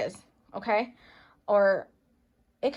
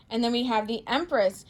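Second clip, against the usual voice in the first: ordinary talk, nothing marked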